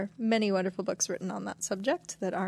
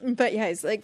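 ordinary talk

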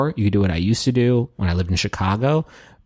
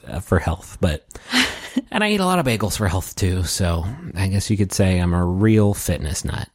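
ordinary talk, nothing marked

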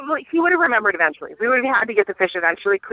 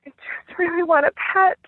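put-on much higher, small sad child voice; sad little boy; 10 semitones higher than usual. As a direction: say it like a heartbroken five-year-old. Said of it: "just really sadly, ... just goes".

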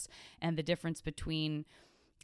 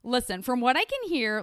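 louder, higher smug know-it-all voice; annoying know-it-all; 7 semitones higher, 8 dB louder than usual. Retold or imitated. Dialect nerd lecturing his sweetie, smug louder know-it-all. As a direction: play the pedantic dialect nerd, raised and loud. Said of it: "the nerd and the annoying person who's like".

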